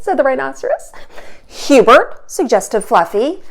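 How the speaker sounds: lively, expressive storybook reading; animated read-aloud for kids; reading to children. A narrator's reading. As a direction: read it aloud for little listeners. Teacher reads picture book aloud.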